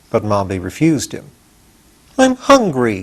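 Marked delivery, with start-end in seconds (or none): 2.2-3.0 put-on high sulky boy's whine. Hungry boy begging the witch for bread, sulky whine. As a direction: sulk as you ask. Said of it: "said Tip in a sulky voice".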